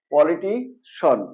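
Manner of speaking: "পলিটিশন" slowly, ending in a single syllable shon. Shown as a demonstration. Teacher showing the correct word, "পলিটিশন" ending in shon. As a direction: say "পলিটিশন" drawn out, poli-ti-shon. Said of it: "recap".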